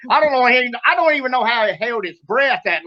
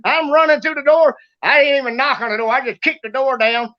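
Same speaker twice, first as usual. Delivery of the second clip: normal talking voice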